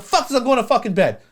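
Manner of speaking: imitating the bride yelling at her groom, pitched far up; mock angry bride yell. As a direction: squeal it like a bride losing her temper. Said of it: "she's like".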